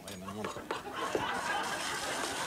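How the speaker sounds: hushed, slow setting up; whispered aside. Real, not acted. Cohost sets water glass by onions, slow whispered aside. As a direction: whisper it slowly, half to yourself.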